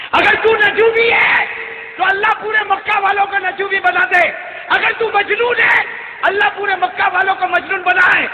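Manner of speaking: high shouted declamation; full-force preaching. Acted. Old mother defending her son against Makkah, fervent shouting. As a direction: cry it out to the crowd.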